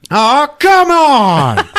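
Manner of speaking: high, loud, drawn-out whine; exasperated plea. Acted. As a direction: plead loudly, stretching the words out. Acted plea after being refused.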